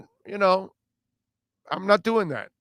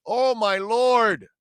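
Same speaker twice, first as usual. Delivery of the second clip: drawn-out exclamation; 6 semitones higher, much slower than usual. Real, not acted. Host reacting to caller's joke.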